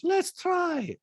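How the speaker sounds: put-on very high-pitched accented caricature; squeaky falsetto mimicry of a reality star; Diana impression. Host mimics reality star in recap, high-pitched caricature. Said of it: "And Diana goes".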